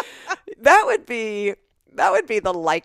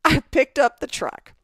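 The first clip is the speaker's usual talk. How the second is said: higher, slower contractor on the phone; 7 semitones higher, 5 dB louder, much slower than usual. Retold or imitated. Contractor telling her about the truck, in a higher, slower put-on voice. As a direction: play the contractor calling with news. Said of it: "He's like".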